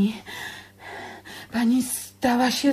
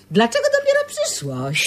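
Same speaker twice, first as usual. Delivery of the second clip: much higher put-on voice for the saying; mock Chinese sage; 14 semitones higher than usual. To her brother, quoting a Chinese proverb in a high mock voice. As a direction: pitch it way up like a wise old Chinese man. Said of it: "Ale w Chinach dodają".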